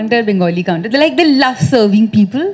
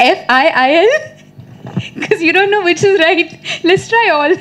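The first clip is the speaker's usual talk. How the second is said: giggling, higher and quicker; laughing through it; 6 semitones higher than usual. Real, not acted. Recalling her school spelling guesses, laughing. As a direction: crack up at your own spelling. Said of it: "laughing at her spelling attempts".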